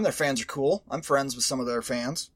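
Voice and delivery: usual speaking voice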